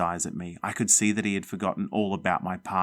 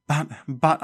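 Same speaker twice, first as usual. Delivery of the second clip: sputtered; 8 semitones higher, 4 dB louder than usual. Acted. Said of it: "I sputtered".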